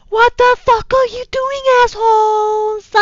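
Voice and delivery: high, loud furious shriek. Acted. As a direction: shriek at them to get off. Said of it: "I shrieked".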